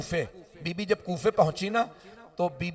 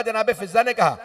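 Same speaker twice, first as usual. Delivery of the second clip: ordinary talk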